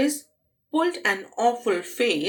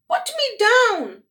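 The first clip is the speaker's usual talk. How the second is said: much higher, loud yelp; panicked demand; 13 semitones higher, 6 dB louder than usual. Acted. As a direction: shriek it while dangling. Girl swung upside down.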